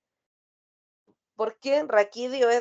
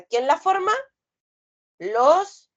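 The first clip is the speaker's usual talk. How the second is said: loud quiz question; 6 semitones higher, 6 dB louder, slower than usual. Real, not acted. Teacher quizzing the class.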